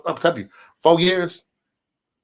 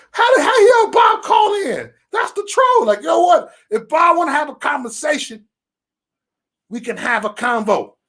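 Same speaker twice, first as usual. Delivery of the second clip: high, loud protest; retold indignant shouting; 11 semitones higher, 3 dB louder than usual. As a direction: shout it high, like an outraged crowd. Re-enacts putting Bob on show.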